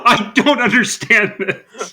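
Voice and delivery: high, slow, laughing disbelief. Real, not acted. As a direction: laugh through the words. Laughing at cohost's horny bit.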